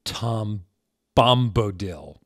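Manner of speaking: dramatic reveal. Acted. Cliffhanger reveal of the guy, slow and loud. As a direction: land the reveal hard.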